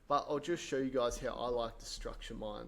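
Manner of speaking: ordinary talk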